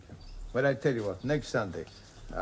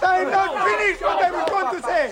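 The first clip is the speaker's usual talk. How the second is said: insistent; 10 dB louder, much slower than usual. Acted. Father insists over the family.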